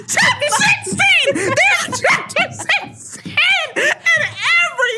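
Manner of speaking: high, drawn-out shriek through laughter; helpless, high-pitched laughing exclamation; laughing shout. Real, not acted. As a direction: crack up while crying out each word slowly. Cracks up over recurring chapter sixteen.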